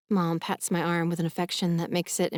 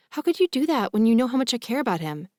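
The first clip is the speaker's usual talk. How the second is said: higher-pitched, accusing question; mild reproach; 5 semitones higher, faster than usual. A narrator's reading. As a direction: confront Mom with a touch of outrage. Daughter confronts her mother.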